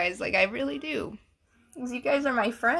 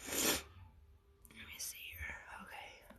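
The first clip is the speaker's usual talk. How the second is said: whispered to herself; whispering; 18 dB quieter, much slower than usual. Real, not acted. Host checking her phone mid-stream, whispered aside to herself. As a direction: whisper while busy on the phone.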